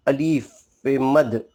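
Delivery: usual speaking voice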